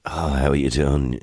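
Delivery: low, slow, put-on poorly voice; fake sick voice. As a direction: drag it out like someone faking illness to skip work. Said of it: "Did you put the voice on".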